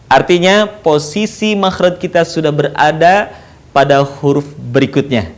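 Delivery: normal talking voice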